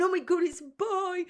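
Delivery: excited husband. Retold or imitated. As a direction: gush it like a thrilled dad-to-be. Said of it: "and he's going".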